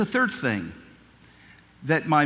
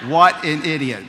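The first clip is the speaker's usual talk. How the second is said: louder, mock-scolding himself; loud self-mockery; 8 dB louder than usual. Real, not acted. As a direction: call himself an idiot with a loud laugh in the voice. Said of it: "self-mockery".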